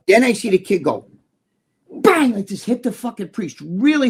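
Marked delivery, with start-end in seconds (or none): 1.9-2.3 sudden high, loud "bang"; big impact sound word; loud imitated bang. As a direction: punch out a bang like a hard hit. Acting out the kid's hit.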